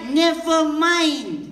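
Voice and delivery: slow and stretched; drawn-out phrase. Retold or imitated. Mocking wordy phrase, slow. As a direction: drag it out as if too long. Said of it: "we don't like".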